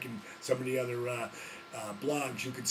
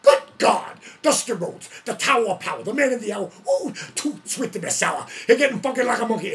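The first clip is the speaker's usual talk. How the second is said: fast, much louder and higher promo yell; wrestling promo rant; 9 semitones higher, 14 dB louder than usual. Retold or imitated. New manager ranting at Dusty Rhodes over the phone, yelling. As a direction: rant like a ring announcer cutting a promo. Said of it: "I went on this rant".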